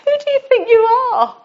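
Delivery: high, fast, scornful sneer; mock the speaker as a stern critic; a sneering voice putting her down; harsh inner voice. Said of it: "someone could have audibly said to me".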